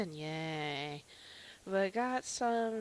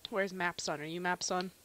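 ordinary talk

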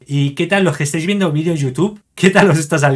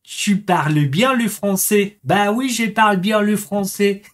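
put-on slow, higher-pitched French accent; drawn-out sing-song French accent; showy French accent; 5 semitones higher, slower than usual. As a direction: show off a French accent playfully. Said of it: "¿Tengo buen acento o no?".

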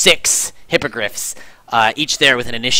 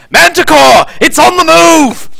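very high, loud alarm cry; long drawn-out warning shout; sentry's shout; 12 dB louder, slower than usual. Acted. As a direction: call the alarm from a high lookout. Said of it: "a sentry from the pinnacle shouts".

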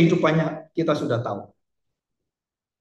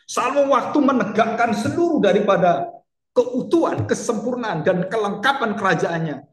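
impassioned preaching; 6 semitones higher, 4 dB louder than usual. Acted. Preaching at full force.

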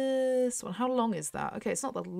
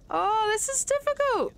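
tense exclaim; 11 semitones higher than usual. Real, not acted. Tense race moment.

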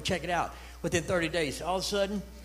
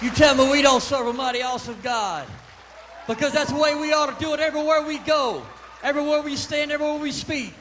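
loud, high full-force preaching; 13 semitones higher, 9 dB louder than usual. Acted. Preacher calling the congregation to serve God, in full-force preaching. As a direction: lift the church with it.

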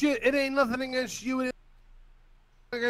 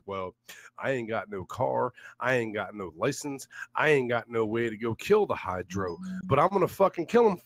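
much lower, sneering parody of the rival's excuses; mocking impression; 14 semitones lower than usual. Mocking the rival's excuses to his subscribers, sneering. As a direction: mimic him like a dopey loser making excuses. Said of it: "tell your subscribers the fucking truth".

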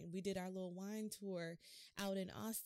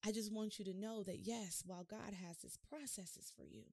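usual speaking voice

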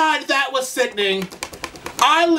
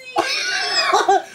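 high, delighted cackle; real giddy laugh; 11 semitones higher than usual. Real, not acted. Laughing at the video.

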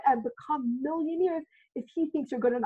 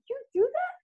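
delighted surprise; 11 semitones higher than usual. Retold or imitated. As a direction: pitch jumps up in delight. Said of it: "I was just like".

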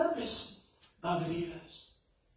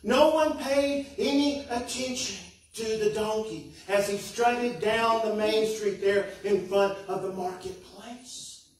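ordinary delivery

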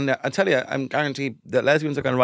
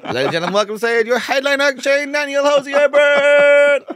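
1 stage announcer; 13 semitones higher, 7 dB louder than usual. Pretend. Introducing the headline act to a roaring crowd, booming. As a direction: announce him like a stadium act.

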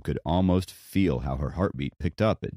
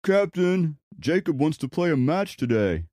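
calling out; 12 semitones higher than usual. Acted. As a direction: call to the captain. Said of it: "[name] called Kyle".

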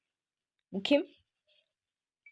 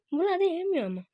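ordinary talk

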